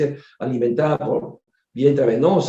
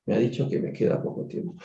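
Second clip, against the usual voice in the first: breathless, whispered words of a dying woman; faint voiceless murmur; weak whisper; 5 semitones lower, 8 dB quieter than usual. Retold or imitated. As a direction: whisper it from a wheelchair, barely able to speak. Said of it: "la señora que estaba así recostada en su silla".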